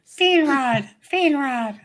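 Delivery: high loud squeaky creature call of Fiendrod; high hammed-up Pokémon cry. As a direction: shout it like Pikachu calling its name.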